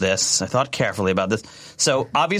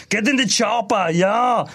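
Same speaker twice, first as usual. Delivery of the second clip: Arnold Schwarzenegger impression; 10 semitones higher, slower than usual. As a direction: belt it like a muscle-bound action star. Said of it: "like, Arnold Schwarzenegger".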